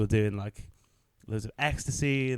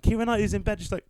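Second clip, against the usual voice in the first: ordinary talk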